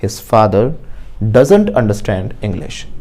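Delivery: very slow, word by word, for students to repeat; slow model sentence. Shown as a demonstration. Teacher modelling an answer for the class, very slowly. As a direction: spell each word out slowly like a dictation. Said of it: "तो बोलो".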